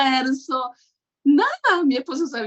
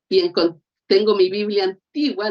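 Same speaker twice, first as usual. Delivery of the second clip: usual speaking voice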